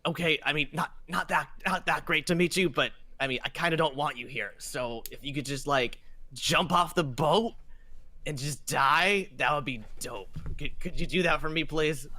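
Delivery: lightly voiced captain, casually hostile; haughty ship captain. Captain asks unwanted visitor to leave his boat, lightly voiced disdain. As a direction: drag out the unwelcome request, then hurry the plea.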